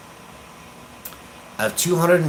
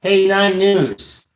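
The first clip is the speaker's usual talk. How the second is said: sarcastic call-out; 6 semitones higher, 3 dB louder, slower than usual. Real, not acted. Calling out to the station.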